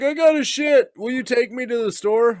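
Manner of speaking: imitating tipsy Marco. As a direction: beg like a tipsy coworker. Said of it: "He's like".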